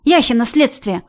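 blurted out. Acted. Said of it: "выпалила".

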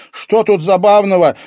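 high, loud, distressed question; upset girl's outcry; close to tears. A narrator's reading. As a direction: protest as if about to cry. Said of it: "Девушка чуть не плакала".

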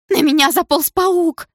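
high, loud, slow frightened wail; tragic tone. Acted. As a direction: wail it like a disaster. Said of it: "трагическим тоном известила".

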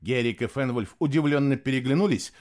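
usual speaking voice

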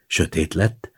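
ordinary delivery, nothing marked

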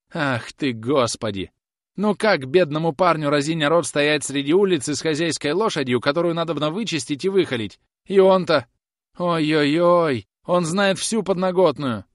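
high, loud wail; panicky lament. Acted. Groom frets about horse and all-knowing master, wailing. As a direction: moan out loud in helpless distress.

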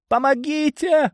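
high, drawn-out trembling cry for help; дрожащий голосок. A narrator's reading. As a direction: plead for rescue in a thin, high voice. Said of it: "услышал я дрожащий голосок". (дрожащий высокий голосок, протяжно зовёт на помощь)

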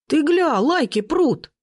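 high-pitched, excited marvelling; thrilled teenager. A narrator's reading. Teenager filming the row, excitedly marvelling. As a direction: pitch it up in gleeful amazement. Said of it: "восхитился подросток".

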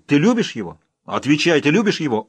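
jealous jarl demanding the truth. Acted. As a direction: press her hard for an answer. Rollo interrogates Emma jealously.

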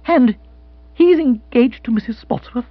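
high-pitched, halting incredulity; stunned disbelief. Acted. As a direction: force out an astonished question. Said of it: "Bill found speech, though with difficulty".

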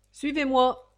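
loud, high call to follow; commanding. A narrator's reading. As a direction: order the line to move. Usher orders the prisoners along.

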